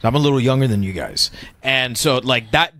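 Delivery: ordinary talk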